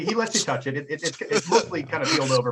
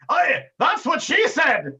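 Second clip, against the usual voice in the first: loud, high, drawn-out sailor's yell; hearty sailor's shout; 5 dB louder, slower than usual. Acted. First mate heckling from the corner, hearty shout. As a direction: holler it slowly like a rowdy first mate.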